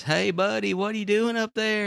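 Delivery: high, friendly passerby calling up; concerned passerby. Retold or imitated. Joke teller voices a passerby, calling up high. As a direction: call up to the man on the overpass.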